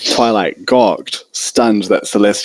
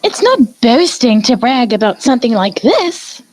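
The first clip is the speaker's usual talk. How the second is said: high emphatic exclamation; exclaiming. A narrator's reading. Rarity insisting to Fluttershy, an eager high exclamation. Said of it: "Rarity exclaimed".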